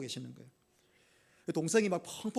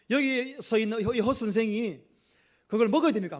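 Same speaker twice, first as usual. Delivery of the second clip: louder, pressing question to the congregation; loud rhetorical question; 3 semitones higher, 6 dB louder than usual. Acted. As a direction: challenge the crowd, falling hard on 먹어야. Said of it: "질문합시다".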